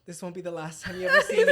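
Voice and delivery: telenovela exit. Retold or imitated. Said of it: "I was tears in my eye".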